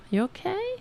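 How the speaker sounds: high, sing-song cooing to the cat; high cooing pet voice, soft and concerned. Real, not acted.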